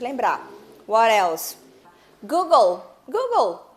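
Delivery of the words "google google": "Google" high and loud with an L ending; final L pronounced. Shown as a demonstration. Teacher drilling the word, final L pronounced. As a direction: call out "Google", closing on the L. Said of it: "Olha o Lzinho no final".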